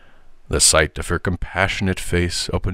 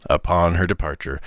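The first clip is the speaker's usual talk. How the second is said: usual speaking voice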